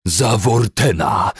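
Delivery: loud, high, drawn-out battle cry; screaming. A narrator's reading. Commander screams while hacking at captives, screaming. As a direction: scream it as you strike. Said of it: "wrzeszczał".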